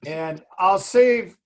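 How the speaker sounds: ordinary delivery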